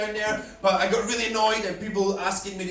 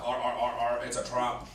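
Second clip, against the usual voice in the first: mock Star Wars accent; 8 semitones lower, 5 dB quieter than usual. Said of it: "that, uh, Star Wars accent".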